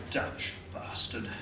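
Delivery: slow, whispered curse under the breath; muttered curse. Acted. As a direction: mutter it to yourself, resentfully. Resentful thought under his breath.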